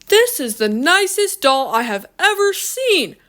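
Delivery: high, gushing amazement; delighted admiration. A narrator's reading. Shopper admiring what he thinks is a doll, delighted. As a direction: gush over it, pitched well up.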